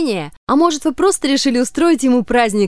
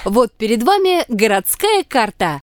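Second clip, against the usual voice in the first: normal talking voice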